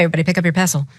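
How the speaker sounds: plain reading voice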